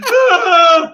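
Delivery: loud, high imitated bray; high braying wail; donkey noise. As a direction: let out a donkey's cry, half laughing. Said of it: "That was the noise the donkey makes".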